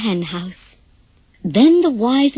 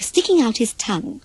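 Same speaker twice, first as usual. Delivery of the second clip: usual speaking voice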